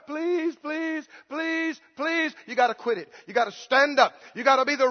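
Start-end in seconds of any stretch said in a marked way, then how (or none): 0.1-2.3 softer, repeated mock pleas; pleading, drawn-out please after please; whining pleader. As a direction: mimic a beggar asking endlessly. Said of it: "a pleader and ask over and over".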